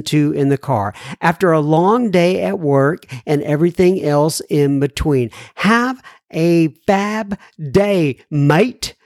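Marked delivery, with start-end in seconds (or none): 5.6-9.0 slow, drawn-out mock Australian sign-off; Australian accent. Host reading an Australian listener's sign-off, amateur Australian accent. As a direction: do a not-quite-right Australian accent, stretching each word. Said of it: "my accents are not up to par".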